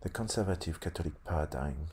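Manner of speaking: ordinary talk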